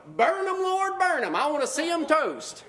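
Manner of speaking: high, gloating mock cry for fire; mock gleeful Jonah. Preacher plays Jonah gloating on the hill, high mock glee. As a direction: cheer for destruction like Jonah on the hill. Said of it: "sitting up on the hill going".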